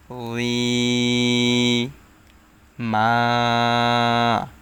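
উই and মা each held long and even; long drawn vowels. Shown as a demonstration. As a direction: stretch each syllable for one alif. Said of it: "এক আলিফ টেনে পড়তে হবে". (উই মা টেনে পড়া)

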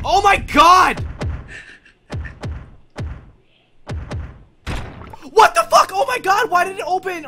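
high, loud shrieking panic; terrified horror victim. Real, not acted. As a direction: shriek as the door opens. Jump scare, door opens.

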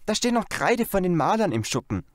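put-on girl Janet. A narrator's reading. Janet remembers the painters' chalk, light girl voice. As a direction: chirp it like a keen little girl.

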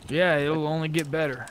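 ordinary talk, nothing marked

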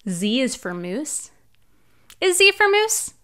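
normal talking voice

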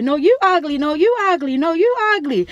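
put-on high, childish back-and-forth taunt; kids bickering. Little kids fussing at each other, high taunting voices. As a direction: mimic little kids squabbling. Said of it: "they fussing back and forth".

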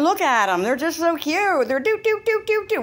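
doting owner cooing at a little dog; cooing baby talk. Retold or imitated. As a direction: coo over it like a newborn. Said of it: "we just wanna see them as".

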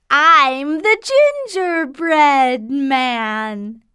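high teasing singsong shout; gingerbread man voice. Acted. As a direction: taunt them in a high voice. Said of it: "the gingerbread man shouts".

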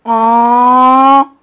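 sudden loud startled scream; shocked scream. Acted. Shocked scream as the dream breaks.

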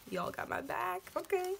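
squeaky high excited voice; high-pitched squeal. Retold or imitated. Gushing thanks quoted to viewers.

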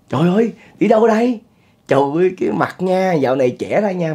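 high, delighted exclamations; overjoyed greeting. Retold or imitated. The man gushes at the gangster who came to collect, overjoyed high greeting. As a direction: gush over him like a long-lost brother.

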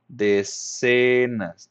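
"decenas" drawn out syllable by syllable; slow, stretched word. Real, not acted. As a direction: say "decenas" very slowly, as if writing it. Writes the word while saying it. ("decenas" muy lento y alargado)

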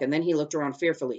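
usual speaking voice

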